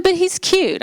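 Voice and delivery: high, insistent sister's pitch; eager sister. Retold or imitated. Sister on the phone pushes a date, eager and high. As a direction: sell the man as cute, eagerly.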